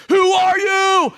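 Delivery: loud, slow, drawn-out demand; emphatic shout. Retold or imitated. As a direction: bellow the question slowly and hard. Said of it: "it's emphatic in the Hebrew".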